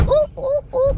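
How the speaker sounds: sudden high-pitched ooh ooh ooh; surprised squeal. Real, not acted. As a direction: squeal in surprise as you drop into the seat. Car seat sits low.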